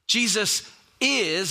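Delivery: "is" stretched out after a slow "Jesus"; stress on IS. Real, not acted. Preacher repeating the key line to the congregation, stress on "is". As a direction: hold and emphasize the word "is". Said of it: "I put emphasis on is".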